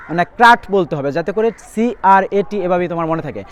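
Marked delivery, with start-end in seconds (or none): none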